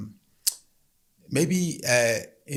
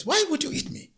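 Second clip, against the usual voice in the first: alarmed woman. Acted. Woman questions the lion.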